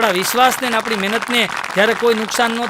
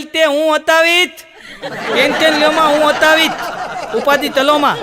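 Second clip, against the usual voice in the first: put-on high, lisping baby talk, louder; lisping childish voice; 4 semitones higher, 3 dB louder than usual. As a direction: babble like a toddler comforting granny. Vitthal lisping again.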